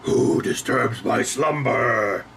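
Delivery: slow, booming ancient statue voice; grinding stone statue. Retold or imitated. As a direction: rumble it out like waking stone. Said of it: "that was obviously, what's his face, Frank Welker".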